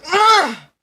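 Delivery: very high, loud hammed-up yell. Acting out the hero's feeling, high loud mock scream. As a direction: belt out a cartoon scream. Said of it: "You feel".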